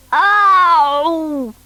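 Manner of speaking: loud high yelp of pain. Acted. Bunkie yelping about his hurt leg, loud pained cry. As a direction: cry out at the sore foot. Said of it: "moaning and clutching his foot".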